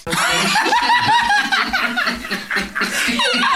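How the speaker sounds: very high screaming laughter; shrieking laugh. Real, not acted. Woman teased about spoiling Christmas, shrieking with laughter.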